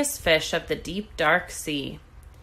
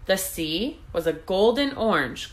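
plain reading voice

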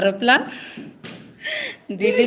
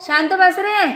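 sharp shout; 10 semitones higher, 6 dB louder than usual. Real, not acted. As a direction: shout it, higher and louder. Telling someone off at home.

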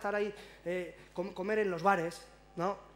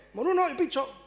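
loud, higher brusque answer; curt waiter; 7 semitones higher, 5 dB louder than usual. Retold or imitated. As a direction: bark it back at the customer.

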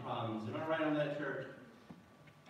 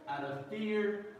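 ordinary talk

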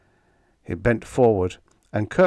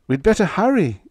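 high, loud and pressing; desperate, raised-pitch plea; desperate urging; 11 semitones higher, 6 dB louder than usual. A narrator's reading. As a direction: urge him on desperately. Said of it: "Kirk said desperately".

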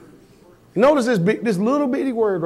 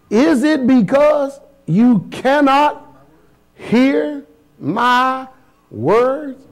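slow, drawn-out and higher question; scripture reading; 5 semitones higher, much slower than usual. Acted. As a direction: stretch Christ's question out slowly. Reads Christ's question slowly.

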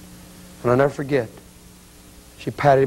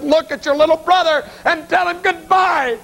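anguished cry. Retold or imitated. Mother forces son to face his dead brother, anguished high cry. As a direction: cry it out as a desperate order.

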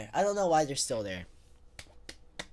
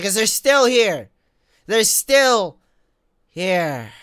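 frustrated; 4 semitones higher, 8 dB louder, much slower than usual. Real, not acted. Frustrated at idle enemies.

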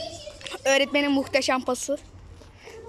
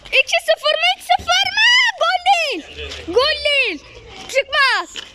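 excited football commentator; 11 semitones higher than usual. Acted.

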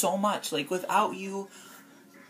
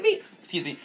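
laughing stumble; faster than usual. Real, not acted.

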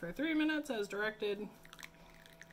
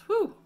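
strong mint; 14 semitones higher, 15 dB louder than usual. Real, not acted. Reacting to strong mint smell.

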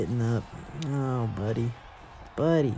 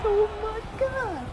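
ecstatic disbelief; 9 semitones higher than usual. Real, not acted. Gamer celebrating an early leg-kick knockout, ecstatic. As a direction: shriek with delight at the knockout.

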